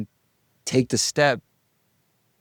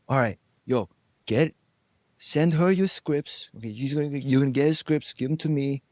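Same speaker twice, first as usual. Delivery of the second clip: a lightly voiced pushy producer, pitched higher; bossy producer; 6 semitones higher than usual. Producer ordering the party host to fetch scripts, mock bossy voice. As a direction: toss orders at the host like she works for you.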